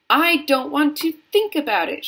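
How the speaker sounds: a contained cry of horror; mild shriek. A narrator's reading. Missus Gloop refusing to think about it, mildly shrieking. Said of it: "shrieked Missus Gloop".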